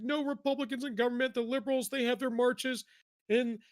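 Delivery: hammed-up high whimpering plea; a whiny, teary caller played for laughs; mock crying caller. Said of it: "the guy's, like, crying".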